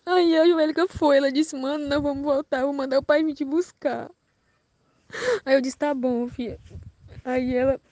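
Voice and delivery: fighting back real crying while speaking; voice breaking into tears. Real, not acted. Recounting being taken by force. (voz embargada de choro, mais aguda)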